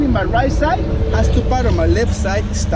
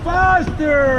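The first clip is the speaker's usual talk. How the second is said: shouting; 6 semitones higher, 4 dB louder than usual. Real, not acted. Rafting guide urging the crew.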